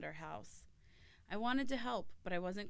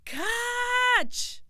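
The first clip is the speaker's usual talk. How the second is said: long, high, full-force shout; piercingly high, very loud cry; yell; 13 dB louder than usual. A narrator's reading. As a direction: yell it out as one drawn-out command. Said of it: "as Appa yelled".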